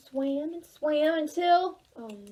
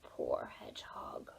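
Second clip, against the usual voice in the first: softly whispered pity; 14 dB quieter than usual. A narrator's reading. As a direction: murmur it like a secret sorrow. Storyteller sympathy, whispered.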